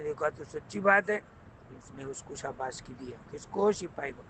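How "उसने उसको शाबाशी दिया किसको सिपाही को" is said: ordinary delivery, nothing marked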